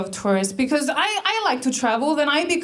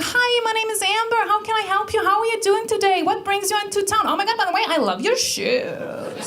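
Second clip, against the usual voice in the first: high-pitched, gushing gas station greeter; rapid-fire overfriendly American, drawing out the last line; Amber; 10 semitones higher, faster than usual. Retold or imitated. Amber greeting a tourist at her gas station, high and gushing. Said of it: "She was like".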